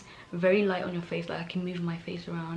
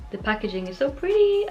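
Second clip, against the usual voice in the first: high, louder cooing gush; delighted admiration; 7 semitones higher, 6 dB louder than usual. Real, not acted. Admiring product packaging for viewers, delighted high gush. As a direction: gush over how pretty it is.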